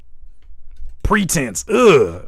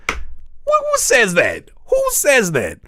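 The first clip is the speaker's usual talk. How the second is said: incredulous; 9 semitones higher, 3 dB louder, much slower than usual. Real, not acted. Incredulous mocking reaction.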